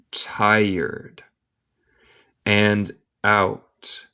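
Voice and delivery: "And ran till she was quite tired" one word at a time; long pauses between words; slow dictation. Shown as a demonstration. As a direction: read it very slowly, isolating each word for learners. Teacher models slowly, then reads connected.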